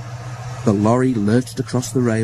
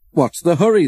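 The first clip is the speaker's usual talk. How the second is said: laughing; 7 semitones higher, 5 dB louder than usual. A narrator's reading. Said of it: "they laughed".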